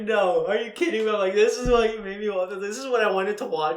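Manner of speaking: high, loud mock outrage; retold protest. Viewer retelling crying at the chosen film, protesting. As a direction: protest loudly in disbelief.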